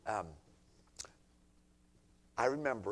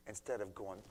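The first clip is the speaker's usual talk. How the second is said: normal talking voice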